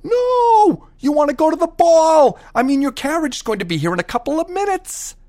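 very high, loud insistent fairy; fairy godmother. A narrator's reading. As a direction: squeal it like a pushy fairy. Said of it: "The fairy godmother said".

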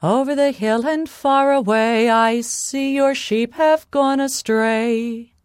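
calling out. Acted. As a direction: call out from afar, holding the last words. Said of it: "she called out".

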